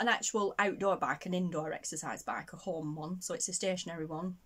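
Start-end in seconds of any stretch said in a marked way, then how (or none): none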